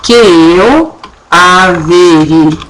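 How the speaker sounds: "averigue" slowly, stress on the U; slow demo. Shown as a demonstration. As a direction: drag out "averigue", stressed U sounding. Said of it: "ficava assim, ó".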